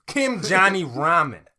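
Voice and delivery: loud, slow showman's announcement; Kim Johnny Ramen. Acted. Attendant telling customer whom to ask for, loud showman. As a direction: switch to brash name-drop. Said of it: "he just switches completely".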